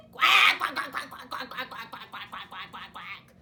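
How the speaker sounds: quacks loud then fading; duck quacks. Acted.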